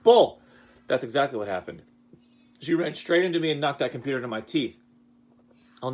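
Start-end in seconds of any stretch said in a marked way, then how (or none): 0.0-0.4 much louder, higher scoff; teacher mocks his student's story, much louder; loud playful scoff. Real, not acted. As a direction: bark it in mock outrage. Rejecting her version of story.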